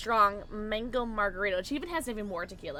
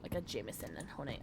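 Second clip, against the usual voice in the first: ordinary talk, nothing marked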